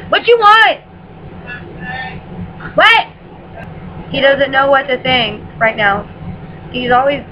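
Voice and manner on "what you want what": shouting back. Real, not acted. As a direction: holler back at the person yelling for you. Shouting back to someone calling her.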